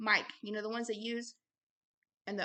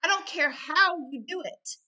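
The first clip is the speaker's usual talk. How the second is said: higher, louder preaching push; forceful exhortation; 6 semitones higher, 6 dB louder than usual. Real, not acted. Preacher urging listeners to fight thoughts, forceful. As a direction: drive it home like an urgent preacher.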